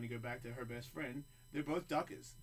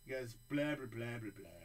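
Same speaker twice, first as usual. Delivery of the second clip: ordinary talk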